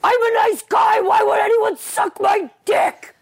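high, loud whiny nice-guy shout; mock a self-pitying club guy; entitled nice guy. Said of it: "The one who's like".

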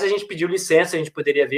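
ordinary talk, nothing marked

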